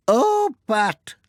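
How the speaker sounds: put-on high, slow old lady voice; fussing, grateful grandmother; Granny Dryden's voice. Old lady thanking the postman for chasing her washing, high and fussing. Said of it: "said Granny Dryden".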